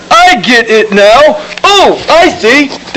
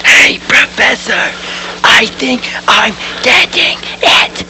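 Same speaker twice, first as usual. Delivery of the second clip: whispering; 6 dB quieter than usual. Real, not acted. Whispering to the professor.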